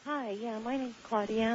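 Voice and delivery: faint, faraway phone voice; almost too quiet to hear; barely audible. Real, not acted. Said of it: "Claudia, I can barely hear you".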